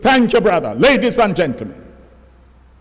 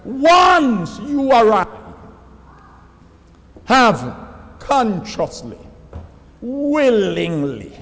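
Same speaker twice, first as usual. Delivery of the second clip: preaching at full force; 3 semitones higher, slower than usual. Acted. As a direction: thunder the warning to the congregation.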